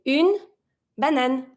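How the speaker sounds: une with a clear u, then banane; French une banane. Shown as a demonstration. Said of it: "to make it sound une".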